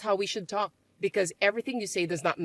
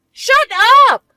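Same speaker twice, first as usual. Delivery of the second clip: shrieking shut up, very high and loud; furious shout; 17 dB louder than usual. Acted. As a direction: scream her down. Sisters' quarrel, shouted.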